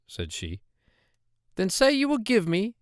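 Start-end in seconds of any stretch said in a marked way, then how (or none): 1.6-2.8 higher, squeaky voice of a hobbling little man; droll little man. Acted. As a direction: pitch him up high and sly. Dwarf demands the child.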